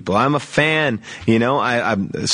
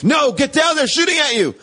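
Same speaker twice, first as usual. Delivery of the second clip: urgent yell; 12 semitones higher than usual. Acted. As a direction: yell it like shots are flying. Acted warning of shooting.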